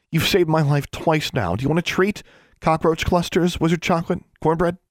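high, chirpy offer; girl coaxing pet. A narrator's reading. Girl offering the raven a treat, coaxing.

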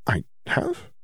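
breathy, hushed surprise; whispered question. Acted. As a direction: whisper it, caught off guard. Hermione, unsure.

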